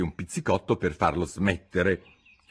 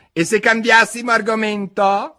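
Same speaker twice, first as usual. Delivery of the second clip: yawning March Hare; 8 dB louder than usual. Acted. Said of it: "con uno sbadiglio".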